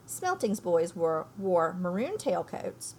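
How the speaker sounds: plain reading voice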